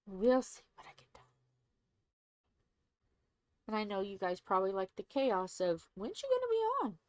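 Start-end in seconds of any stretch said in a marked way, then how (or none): 0.1-1.2 whispered teasing aside. Real, not acted. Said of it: "soft aside to viewers". 5.9-7.0 very high, fast squeaky viewer voice; impatient viewers. Pretend.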